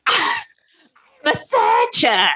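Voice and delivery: high, drawn-out creepy creature voice; creepy Gollum-like visitor. Acted. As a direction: stretch it out in a strained creature voice. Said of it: "You sounded like fucking Gollum".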